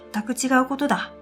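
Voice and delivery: ordinary talk, nothing marked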